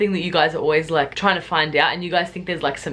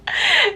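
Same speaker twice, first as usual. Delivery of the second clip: high laugh. Real, not acted. Laughs at own disgust.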